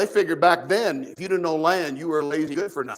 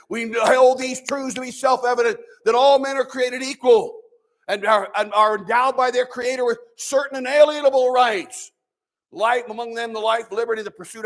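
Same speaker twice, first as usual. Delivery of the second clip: raised, higher declaiming of the founding words; solemn recitation; 5 semitones higher, 3 dB louder than usual. Retold or imitated. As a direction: recite it like a proud orator. Quoting the Declaration aloud.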